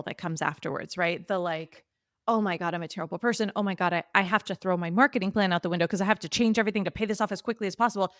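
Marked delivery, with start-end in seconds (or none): 2.3-8.1 rapid, run-on panicky self-talk; mock panic spiral. Borrower spiralling over getting into debt, mock-panicked and rushed. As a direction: race through a spiral of imagined panic. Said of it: "The panic starts rising".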